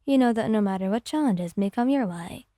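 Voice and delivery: plain reading voice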